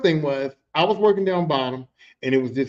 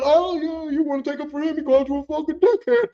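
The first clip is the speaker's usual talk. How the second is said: stirring taunt; 7 semitones higher than usual. Retold or imitated. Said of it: "He said".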